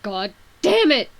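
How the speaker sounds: furious shout of God damn it; angry outburst. Acted. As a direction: yell it out in sudden rage. Karkat takes card vault, shouting below.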